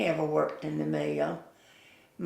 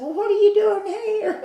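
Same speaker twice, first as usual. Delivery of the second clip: high, loud retold surprise; surprised question; 8 dB louder than usual. Store owner to a visiting reporter, amused surprise. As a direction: exclaim it in mock surprise, half laughing.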